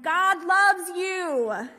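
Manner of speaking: slow, loud shout, high pitched; shouting a blessing at a hard-of-hearing resident. Retold or imitated. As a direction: bellow each word slowly so she finally hears. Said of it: "she just shouted at the woman".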